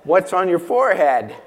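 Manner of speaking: loud, mocking obviousness with a laugh; sarcastic duh. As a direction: make it painfully obvious, laughing. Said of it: "I would have said".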